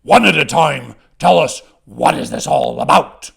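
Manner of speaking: loud, gruffly commanding goblin voice; loud goblin interrogation; thundering goblin. A narrator's reading. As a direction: take charge of squabbling youngsters as a goblin boss. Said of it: "One of the adult goblins thunders".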